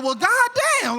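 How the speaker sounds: very high, loud comic disbelief; mock-appalled, voice leaping up; shocked exclamation. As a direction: cry out at scandalous news. Shocked reply to aunt's news.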